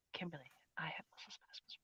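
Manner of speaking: barely voiced, very soft whisper; whispered reading. Real, not acted. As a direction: read it under your breath, almost soundless. Murmurs a viewer's comment while reading.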